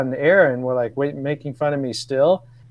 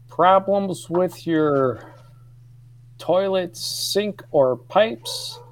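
put-on slow, higher Chicago South Side ad read with a thick accent; hammed-up Chicago South Side accent; 5 semitones higher, much slower than usual. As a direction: read it like a Chicago South Side plumber's cheesy radio ad, drawn out. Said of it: "the voice of what I would imagine was, uh, Chicago South Sider".

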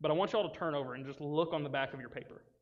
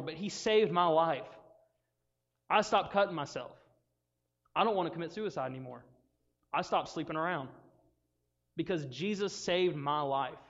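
louder, emphatic preacher-like witness; acted impassioned testimony; 5 dB louder than usual. Healed man telling angry townspeople, impassioned testimony. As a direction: testify earnestly, punching each point.

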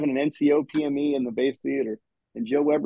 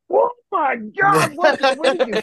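slow, much higher, laughing oh my God; mock shock; 11 semitones higher, slower than usual. Gunny looking through the drum peephole, mock shock, slow and high.

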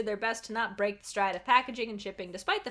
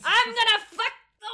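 high, loud villain shout; 11 semitones higher, 6 dB louder than usual. Acted. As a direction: bellow it to the world. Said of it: "Lord Bezos shouted so loudly".

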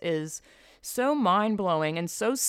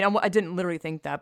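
ordinary delivery

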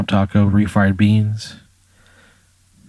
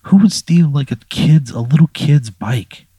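higher and louder, outraged; indignant disbelief; 6 semitones higher, 4 dB louder than usual. Pretend. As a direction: sound appalled that anyone would do it. Player's indignant inner thought voiced.

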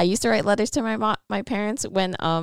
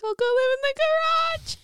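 put-on much higher, pleading little-kid voice; mock child voice. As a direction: play the small kid offering to move out. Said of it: "And I'd like".

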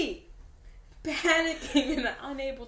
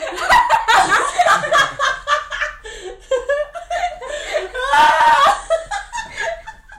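shrieking laugh close to tears; crying with laughter; 10 semitones higher, 11 dB louder than usual. Real, not acted. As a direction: laugh so hard it turns to crying. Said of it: "I'm actually crying".

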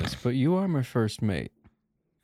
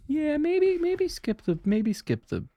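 high squeaky hedge; 4 dB louder, slower than usual. Real, not acted. Hesitant joking pitch up.

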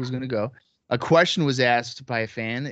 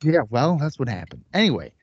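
ordinary talk

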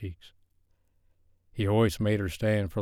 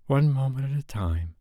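inner voice; 5 semitones higher than usual. A narrator's reading. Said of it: "she reminded herself".

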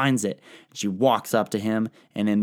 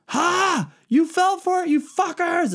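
much higher, louder mocking cheer; gloating Persian taunt; 3 dB louder than usual. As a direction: crow over the tricked enemy in a high jeering voice. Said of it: "The Persians come back and are like".

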